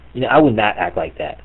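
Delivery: ordinary delivery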